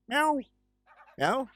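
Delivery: squeaky playful meow; high meow. Pretend. Answering the cat with a squeaky meow.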